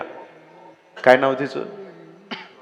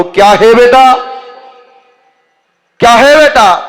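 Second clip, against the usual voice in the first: higher, louder fakir calling back; fakir's reply; 7 semitones higher, 6 dB louder than usual. Acted. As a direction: turn and answer like the fakir. Fakir answers in Hindi.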